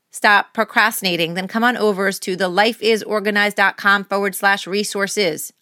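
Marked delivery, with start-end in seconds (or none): none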